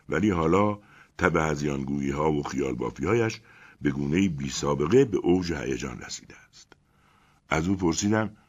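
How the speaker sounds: ordinary delivery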